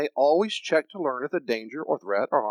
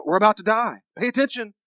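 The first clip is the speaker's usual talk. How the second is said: high, loud warning burst; urgent alarm; 9 semitones higher, 7 dB louder than usual. Acted. Brain warning the mind of danger, urgent alarm. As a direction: sound the alarm, pitched up and loud.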